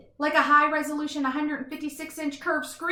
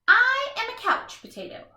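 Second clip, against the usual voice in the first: higher, proud big announcement; proud declaration; 11 semitones higher, 5 dB louder than usual. Acted. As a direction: declare it like a title. Proud self-introduction, book climax.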